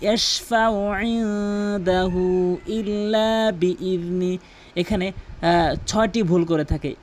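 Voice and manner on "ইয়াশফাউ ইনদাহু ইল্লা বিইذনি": slow, drawn-out melodic tilawat; Quran recitation. Acted. Reciting to viewers as a slow melodic model. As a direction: chant each word long and even.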